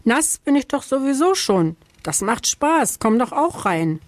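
high, lively character call; lightly called-out storybook invitation; calling back. A narrator's reading. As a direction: call back playfully, pitched well up. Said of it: "ruft Findus zurück".

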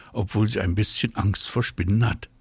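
usual speaking voice

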